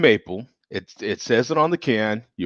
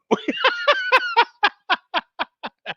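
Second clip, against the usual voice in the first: high burst of laughter. Real, not acted. Big laugh.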